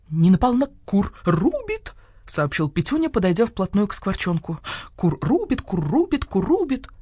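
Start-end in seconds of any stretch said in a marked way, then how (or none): 0.1-1.9 emphatic, lightly squawked correction; rooster voice. A narrator's reading. Rooster comes right up to the starling, emphatic squawk. 4.9-6.9 repetitive clucked insistence; rooster voice. A narrator's reading. Rooster repeats it at the starling, clucking insistence.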